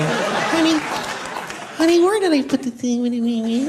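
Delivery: high reedy hammed-up endearment; annoying reedy honey. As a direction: nag sweetly in a pinched voice. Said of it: "that annoying f-, reedy thing".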